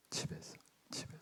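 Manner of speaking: hushed whisper. Real, not acted. Preacher to congregation, hushed whisper. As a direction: lean in and whisper it.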